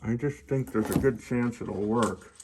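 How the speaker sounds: talking around a flashlight held in teeth; flashlight in mouth. Real, not acted. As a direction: mouth propped open by a flashlight. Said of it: "Sorry, I got a flashlight in my mouth".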